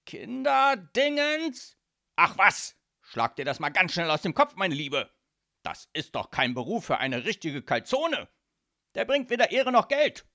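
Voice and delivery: loud, high, scornful father; exasperated boss brushing off his daughter; outraged dismissal. A narrator's reading. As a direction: drag out the first word in disbelief, then shout it down. Father rejects daughter's career wish.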